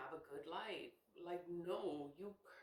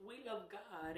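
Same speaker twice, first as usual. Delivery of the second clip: laughing repeat; 3 semitones higher, 4 dB quieter than usual. Acted. Repeating to viewers that she loves God, laughing. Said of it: "repeats with laugh".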